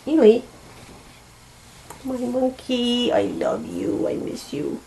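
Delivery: slow, fading to a whisper of love; tender pet talk. Real, not acted. To her sick old cat, tender words fading into a whisper. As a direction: murmur softly to the cat.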